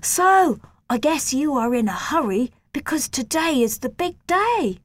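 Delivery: high, bright-pitched friendly teasing; cheerful. A narrator's reading. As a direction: tease him cheerfully. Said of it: "said in a cheerful voice".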